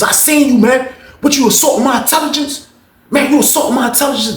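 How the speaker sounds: high-pitched outraged challenge; indignant accusation. Retold or imitated. Acts old head's indignant accusation.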